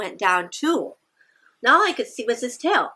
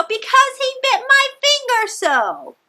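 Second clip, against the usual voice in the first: much higher, louder answer; singsong answer; 5 dB louder than usual. Shown as a demonstration. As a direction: sing it out like a hurt child. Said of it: "And you go".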